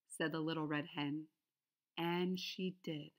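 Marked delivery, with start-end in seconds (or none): none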